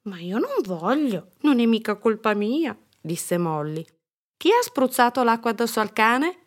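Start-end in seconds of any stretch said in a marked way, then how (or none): 0.0-2.8 put-on high, whiny child protest; protesting little girl. A narrator's reading. Girl protests against cleaning up. 4.4-6.4 loud, high demanding question; cross mother. A narrator's reading. Mother demands who did it.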